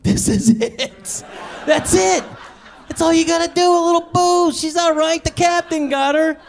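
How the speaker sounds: high, loud self-congratulating boast; smug triumph. Retold or imitated. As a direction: crow about it like you've won. Triumphant dad after baby sleeps.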